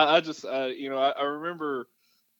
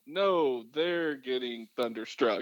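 ordinary talk, nothing marked

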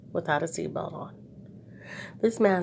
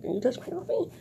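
higher and snooty, "coffee" rising; lip to the sky; 8 semitones higher than usual. Shown as a demonstration. Showing a masked face's look, snooty disdain. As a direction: turn your nose up at him. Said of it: "put the lip to the sky".

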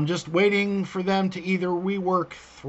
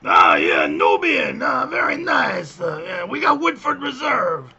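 Watto voice; 7 semitones higher than usual. Retold or imitated. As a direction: boom it like a junk dealer. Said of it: "I'd rather speak like, you know, Watto".

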